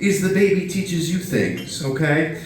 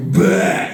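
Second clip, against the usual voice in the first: mock puke sound; 4 semitones higher than usual. Imitating baby puking.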